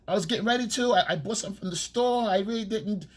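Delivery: high, mocking whiny excuse voice; mock girlfriend excuse. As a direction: mimic a girlfriend making excuses, high-pitched. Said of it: "she go".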